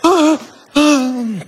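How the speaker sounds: imitando un sobresalto agudo; esposa despertada de susto. Retold or imitated. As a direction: quejido agudo de quien despierta asustada. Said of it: "mi mujer se levanta como". (imitación de esposa sobresaltada)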